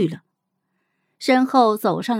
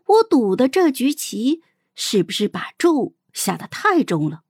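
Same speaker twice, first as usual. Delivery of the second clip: usual speaking voice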